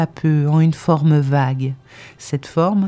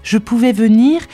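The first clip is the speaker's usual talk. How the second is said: higher, elated, urgent outpouring; fervent confession; 6 semitones higher than usual. A narrator's reading. Woman confesses her change of heart to her companion, fervently elated. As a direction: read it as a rush of exalted conviction, pitch held high.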